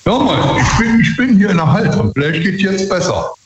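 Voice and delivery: echoey, hall-like room sound; speaking in a big reverberant hall; warehouse echo. Real, not acted. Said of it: "das halt immer noch".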